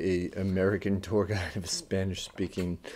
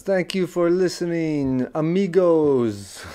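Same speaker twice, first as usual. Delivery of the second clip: put-on loud, high Spanish-accented tour guide greeting; big drawn-out greeting in a mock Spanish accent; 9 semitones higher, 9 dB louder, slower than usual. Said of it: "you sounded like a, like a guy, the tour guide".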